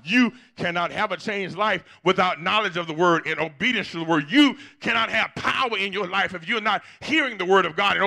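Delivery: loud, high, rising preacher's call building in force; full-force preaching. Acted. Pastor to congregation on obeying the word, full-force preaching.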